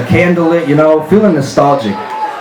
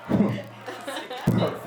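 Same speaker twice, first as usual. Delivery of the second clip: practiced laugh; 7 semitones higher, 16 dB quieter than usual. Pretend.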